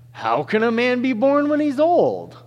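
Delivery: high, loud, mocking disbelief; incredulous scoff. Pastor hams up a puzzled Nicodemus, loud and mocking. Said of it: "I'm a terrible actor".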